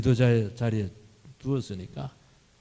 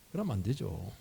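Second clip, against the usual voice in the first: normal talking voice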